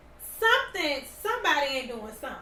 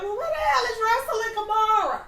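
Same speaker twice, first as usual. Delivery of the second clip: raised-pitch mock outrage; indignant commenters; 6 semitones higher, 4 dB louder than usual. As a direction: demand answers as the online crowd would. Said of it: "That's what people in the comments saying".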